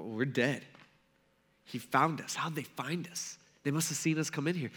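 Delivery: hushed, sinking dread; acted quiet alarm; hearts dropping. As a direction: say it softly and low, as if doomed. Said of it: "I'm sure their hearts drop".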